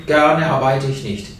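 usual speaking voice

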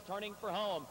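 ordinary talk

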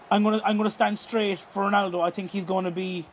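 ordinary talk

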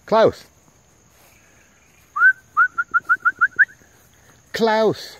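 loud, high call of the dog's name. Real, not acted. Owner calling Klaus back from across the yard, loud high call.

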